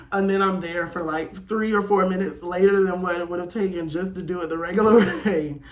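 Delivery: laugh-cry while telling it; words breaking into giggles. Real, not acted. Laughing at own story.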